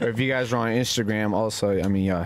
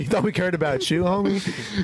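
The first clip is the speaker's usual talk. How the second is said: mock taunting; 7 semitones higher than usual. Teasing a 9/11 birthday person.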